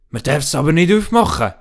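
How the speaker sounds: put-on a boy warning not to open it; boy Stefan. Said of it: "sagt der Stefan".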